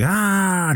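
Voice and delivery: a sharp, very high "ah"; high mock cry. Travis unable to grab anything in space, a very high cry. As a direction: cry out high as you drift helplessly.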